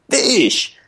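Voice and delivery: high, falling exclamation; Cat impression. Cat ordering at the food dispenser, high-voiced call. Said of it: "he stood there and he goes".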